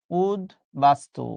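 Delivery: উদ্বাস্তু said very slowly with the ব swallowed, like udbastu; উদ্বাস্তু drawn out syllable by syllable; slow pronunciation demo. Shown as a demonstration. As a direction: spell out উদ্বাস্তু slowly, no clear ব sound. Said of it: "সহজে যেন মনে থাকে".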